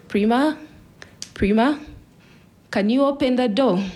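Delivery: calling out. Retold or imitated. Dad at daughter's window, calling out to her. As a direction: call someone by name through a window. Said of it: "and called out".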